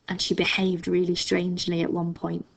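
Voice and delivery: ordinary delivery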